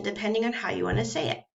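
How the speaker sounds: ordinary delivery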